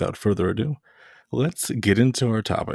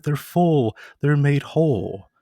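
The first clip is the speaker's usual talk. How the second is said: higher, drawn-out mock sincerity; mimicking gushing claim; 6 semitones higher, slower than usual. Lecturer mocking the Messianic reading, higher and slow. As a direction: sell the cliché with exaggerated wonder. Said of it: "the Messianic world today will say".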